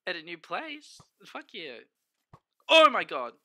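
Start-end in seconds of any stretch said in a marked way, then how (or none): 2.7-3.3 sudden loud fright at a shape in the dark; loud startled cry; startled yelp. Real, not acted. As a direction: jump and blurt it out. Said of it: "that gave me a fright".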